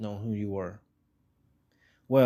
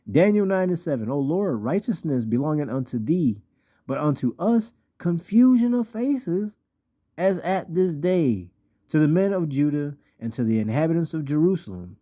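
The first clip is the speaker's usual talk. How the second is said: scripture reading; 4 semitones higher than usual. Acted. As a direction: draw out the key words, pitched up on the big phrases. Reads scripture aloud emphatically.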